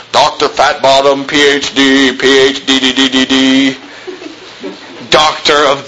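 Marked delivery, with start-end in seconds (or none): 0.1-3.8 mocking. Mocking inflated academic titles.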